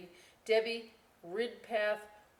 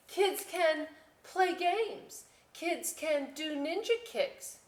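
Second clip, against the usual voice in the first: much higher, slow keen voice; eager girl; 11 semitones higher, 4 dB louder, slower than usual. Acted. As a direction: sell it hard.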